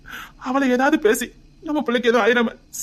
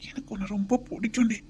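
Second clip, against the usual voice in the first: whisper; 7 dB quieter than usual. Acted. Husband speaks over sleeping wife.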